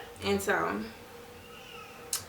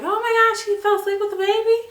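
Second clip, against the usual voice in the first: much higher, louder shocked doctor; surprised doctor; 12 semitones higher, 5 dB louder than usual. Retold or imitated. Doctor surprised the mother dozed off holding baby. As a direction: squeal it like a startled doctor.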